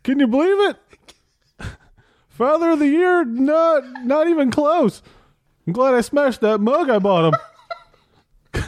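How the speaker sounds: high-pitched griping son; aggrieved comic Jesus. Acted. Jesus complains about his father, high-pitched and aggrieved. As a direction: gripe about dad with drawn-out indignation.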